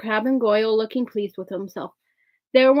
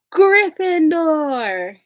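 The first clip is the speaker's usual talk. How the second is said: higher, louder, very slow drawn-out shout; hat's shout; 6 semitones higher, 6 dB louder, much slower than usual. A narrator's reading. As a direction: ring the last word out to the whole hall. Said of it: "heard the hat shout the last word".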